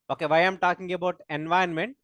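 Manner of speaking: normal talking voice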